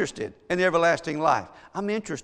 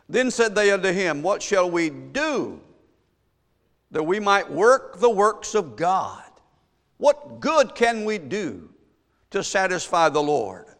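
raised, slow questioning proclamation; preached scripture reading; 3 semitones higher, 4 dB louder than usual. Acted. Preacher reading the crowd's question to Jesus, slow emphatic preached reading. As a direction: stretch the question out for the congregation.

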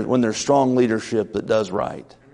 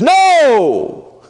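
sudden high shouted no; shouted protest; 12 semitones higher, 7 dB louder than usual. Acted. Peter shouting his refusal at Jesus. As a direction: cry out in protest, high and loud. First take.

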